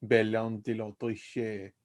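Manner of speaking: mock-French Elvish gibberish; French-sounding Elvish. Shown as a demonstration. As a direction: flow it slowly like French Elvish. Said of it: "like Elvish, but also in French".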